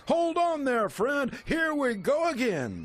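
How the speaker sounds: big high excited call; excited barker. Acted. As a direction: hype the drop like a ride barker. Carnival barker hyping the drop.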